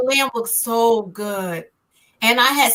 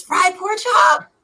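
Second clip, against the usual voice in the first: higher and slow, savoring "fried pork chop"; delighted; 7 semitones higher, much slower than usual. Retold or imitated. Hungry guest spotting pork chop, slow delighted relish. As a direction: relish each word like a treat.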